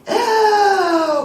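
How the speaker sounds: loud, high desperate gasp; big dramatic gasp; gasp for breath. Acted. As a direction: suck in air like someone suffocating. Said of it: "panting for breath".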